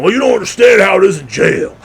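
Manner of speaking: loud, high, overwrought protest; mock self-important lament. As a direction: shout it like a hardened ex-con, hammed up. Mimics boastful ex-inmate cousins.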